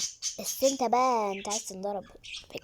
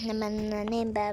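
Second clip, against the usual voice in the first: usual speaking voice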